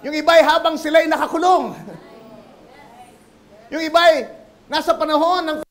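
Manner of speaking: loud, building sermon cadence; a pastor's fiery sermon climax; preaching at full force. Real, not acted. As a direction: preach it to the back row.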